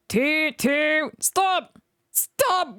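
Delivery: high wailing cries; wailing bus. A narrator's reading. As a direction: wail after the departing train. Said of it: "wailed Bertie".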